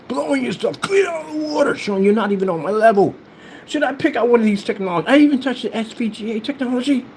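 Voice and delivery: high-pitched incredulous rant; mock indignation. Taunting rivals over old videos, mock indignant. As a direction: pile up rhetorical questions, voice pitched high.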